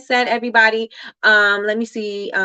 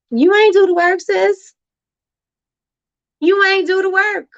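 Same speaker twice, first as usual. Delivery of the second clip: sassy call-out; 10 semitones higher, 3 dB louder than usual. Real, not acted. Calling out excuses.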